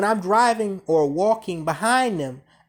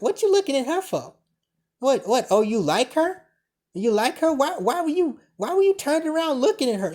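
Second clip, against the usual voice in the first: higher, accusing mock girlfriend voice; mock jealous girlfriend; 6 semitones higher than usual. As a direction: imitate her upset nagging, pitched up. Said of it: "She's so upset".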